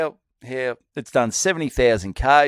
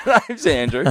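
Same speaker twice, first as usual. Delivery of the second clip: higher, louder laughing echo; 7 semitones higher, 3 dB louder than usual. Real, not acted. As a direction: repeat the joke while cracking up. Laughing at the name joke.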